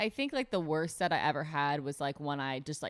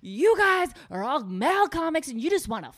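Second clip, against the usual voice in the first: retold tirade; 10 semitones higher, 10 dB louder than usual. Comedian roasting the male comics who teased her, loud high tirade. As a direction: blast them, loud and high, like you mean it. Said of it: "And I was just like".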